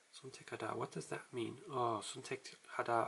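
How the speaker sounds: ordinary delivery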